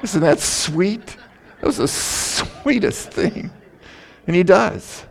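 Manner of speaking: a preacher cooing tenderly over God's love for a church sister. Real, not acted. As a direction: melt over how sweet it is.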